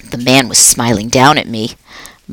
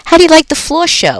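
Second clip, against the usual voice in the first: friendly, higher, lively janitor voice; a jolly night janitor chatting to a pet; upbeat, chatty custodian; Aldo the cheerful janitor; 13 semitones higher, faster than usual. Acted. Aldo joking.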